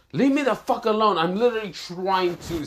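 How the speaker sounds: high-pitched exasperated complaint; angry outburst. Acted. Hero interrupted while summoning his weapon, angry high-pitched rant. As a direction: lash out, pitch jumping up. Hero turns round, snaps.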